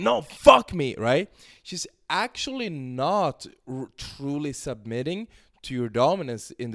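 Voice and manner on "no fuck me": much higher and louder demanding cry; mock yell. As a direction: shout it back at him, impatient. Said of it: "she's yelling".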